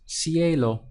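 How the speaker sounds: cielo said slowly, stressing cie; Spanish word slowly. Shown as a demonstration. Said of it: "So we have ci-a-lo".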